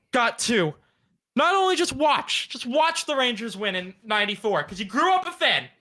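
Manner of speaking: high-pitched aggrieved plea; hammed-up hurt, pitched far above normal talk; mock-emotional. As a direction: plead your grievance in a high, wounded voice. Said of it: "I'm gonna get emotional again".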